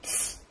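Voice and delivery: ts soufflé, sans voix, juste le son; son t isolé. Shown as a demonstration. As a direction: faites siffler ts doucement. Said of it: "d'abord le son". (son ts chuchoté)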